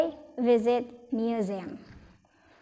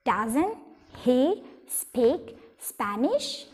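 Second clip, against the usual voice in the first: he speak Spanish stretched out with a rise; slow teacher demo of a question; very slow model question; much slower than usual. Shown as a demonstration. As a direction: drag it out slowly for students.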